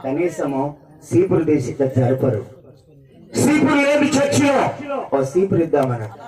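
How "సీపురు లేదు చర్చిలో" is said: high-pitched preacher's cry; forceful preaching. Real, not acted.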